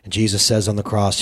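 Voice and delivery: ordinary talk, nothing marked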